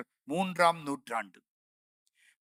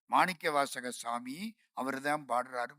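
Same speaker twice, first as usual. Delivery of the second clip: ordinary talk, nothing marked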